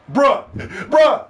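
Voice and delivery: high, loud imitation of hyped fans; mock fanboy bruh. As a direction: parody their excited "bruh". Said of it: "You know how they talk".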